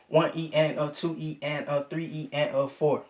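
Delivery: hushed counting of subdivisions; soft subdivision count. Shown as a demonstration. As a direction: quietly run the one E and a. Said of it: "Or you could say".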